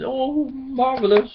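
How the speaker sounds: high, delighted "Oh, marvelous". Acted. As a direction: gasp with delight at the hat. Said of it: "he was delighted".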